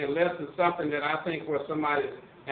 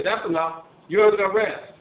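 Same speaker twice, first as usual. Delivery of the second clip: retold arrest shout; 4 semitones higher, 8 dB louder than usual. Officer arrests old lady.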